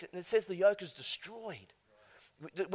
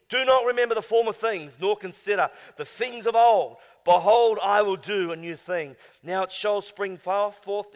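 louder proclaiming reading; scripture reading; 2 semitones higher, 8 dB louder than usual. Acted. Preacher proclaiming scripture to the church, louder. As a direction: declaim the verse to the church.

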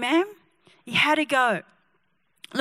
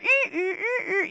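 high, thin repeated squeak; imitated squeaking; 6 semitones higher, 5 dB quieter than usual. Mimicking the ad for the congregation, imitated squeaking. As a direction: make short, high "ee" squeaks.

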